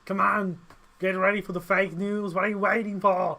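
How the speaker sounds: loud hammed-up Austrian action-hero accent; Arnold Schwarzenegger. Host retries the impression on co-host, loud Austrian accent. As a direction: urge them on in a mock Austrian accent. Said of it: "trying to do Arnold Schwarzenegger".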